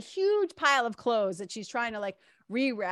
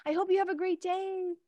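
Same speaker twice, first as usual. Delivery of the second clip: heartfelt thanks; 10 semitones higher than usual. Retold or imitated. Shop girl to departing helpful customer, heartfelt thanks. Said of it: "You can just hear it in her voice".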